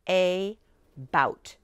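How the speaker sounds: "a bout" as AY... BOUT, slowly split; two distinct stressed syllables in "a bout"; think-to-spell pronunciation. Shown as a demonstration. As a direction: exaggerate the A in "a bout" into its letter name. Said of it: "We're going to think to spell".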